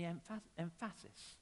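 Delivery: "emphasis" stressed on its middle syllable, em-FASS-is; deliberately misplace the stress in "emphasis", em-FASS-is; shifted word stress. Shown as a demonstration. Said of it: "He's just shifting the".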